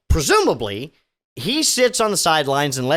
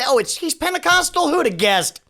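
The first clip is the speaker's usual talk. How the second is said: mock surprise; 9 semitones higher than usual. Real, not acted. Mock surprise at pastor's church.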